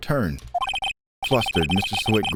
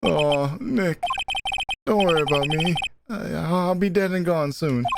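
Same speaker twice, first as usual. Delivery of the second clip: high, mournful acted whine; high-pitched melodramatic gloom; self-pitying whine; 6 semitones higher than usual. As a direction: sigh it out as a doomed sad sack. Larry being gloomy and self-pitying.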